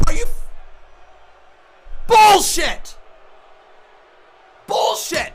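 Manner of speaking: angry shouting fan; furious shout. Real, not acted. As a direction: yell it in real outrage. Fan raging at wrestling finish.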